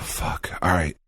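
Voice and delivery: ordinary talk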